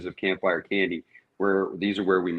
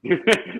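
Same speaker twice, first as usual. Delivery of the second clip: laugh; 10 semitones higher, 4 dB louder than usual. Real, not acted. Struggling to open the jar.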